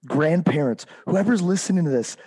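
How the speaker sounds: usual speaking voice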